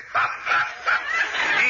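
very high breathy shrieking laugh. Acted. Professor recalling Bugs Bunny to Mel, shrieking laugh.

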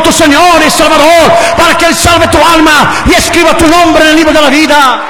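loud, ringing evangelistic appeal; impassioned revival preaching; preaching at full force. Real, not acted. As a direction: drive each phrase home to a congregation.

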